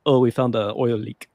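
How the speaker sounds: mock Russian accent on the quote; a hint of a Russian accent, joking. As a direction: put on a light Russian accent. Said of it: "that's my best Russian".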